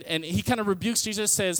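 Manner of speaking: ordinary talk, nothing marked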